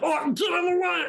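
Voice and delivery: high panicked yelp of a shoved goblin; panicked goblin shout. Acted. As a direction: shriek at him to move.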